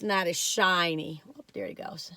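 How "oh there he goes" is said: soft whispered aside; whispering. Real, not acted. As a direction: whisper it under your breath.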